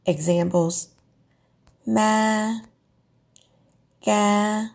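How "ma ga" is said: Ma and ga held level at mid pitch; flat steady Ma, ga. Shown as a demonstration. Teacher gives level-tone examples. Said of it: "The first is a flat tone".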